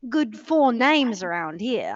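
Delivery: put-on slow, drawn-out Australian accent. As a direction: stretch it out like a matey Aussie. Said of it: "get into your Australian".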